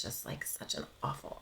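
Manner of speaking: whispered, nearly gone. Real, not acted. Mother whispering about the awful hospital days. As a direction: breathe it out in a hush.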